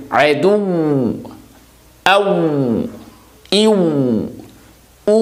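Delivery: عدو with the و held and stretched out; lengthened waw. Shown as a demonstration. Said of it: "اس کی بھی آواز کو لمبا سے کریں گے". (عدو میں و کی آواز لمبی کر کے)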